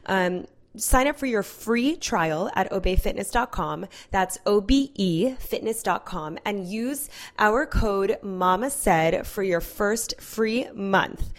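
upbeat podcast sponsor read. Acted. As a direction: sell the app like a friend. Host reads sponsor ad.

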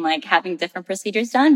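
normal talking voice

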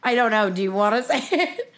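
laughing at the end of the question; nervous laughter. Real, not acted. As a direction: ask it through a real laugh. Laughing nervously at coming confession.

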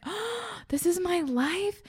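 gasped, high-pitched wonder; slow, astonished discovery, as if newly woken; amazed awakening. Acted. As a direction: play up the dawning amazement. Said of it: "like you just woke up in this body".

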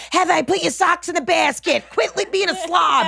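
high, nagging mom impression; mock-scold like a fussy mother; mom yelling. Imitating her mom scolding her about socks, high and nagging. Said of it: "And she just yells at me".

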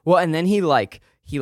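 ordinary talk, nothing marked

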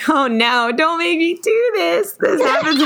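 high, helpless giggly plea; cracking up; laughing protest. Real, not acted. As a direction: squeal through laughter at your own failed accent. Laughing at failed accent attempt.

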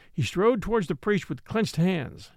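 usual speaking voice

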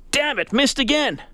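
retold frustration. Golfer cursing a second missed putt, mock frustrated. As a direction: act out a golfer's growing exasperation. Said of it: "get his frustration out".

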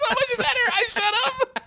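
high, breathless laughter through words; laughing hard. Real, not acted. Comic repeating a funny line while laughing hard.

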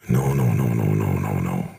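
lightly voiced menacing dogs; guard dog refusing. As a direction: sink into a low guard-dog rumble. Guard dogs refusing an unwelcome intruder.

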